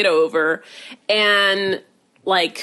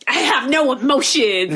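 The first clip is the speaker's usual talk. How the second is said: slow, higher mocking put-on voice; mocking imitation; 4 semitones higher than usual. Mom making fun of son-in-law, mocking imitation. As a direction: parrot him slowly to poke fun. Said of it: "making fun of him".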